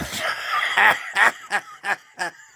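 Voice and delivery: high, loud peals of laughter; real laughing fit. Real, not acted. Joker finishing the joke, high loud laughter. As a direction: explode laughing at your own punchline.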